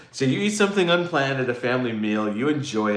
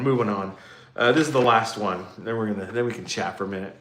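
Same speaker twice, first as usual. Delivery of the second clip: ordinary delivery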